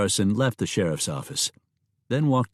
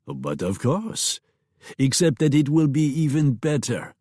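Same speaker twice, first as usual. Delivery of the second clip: put-on French accent; 5 semitones higher than usual. Longmont promises a better menu.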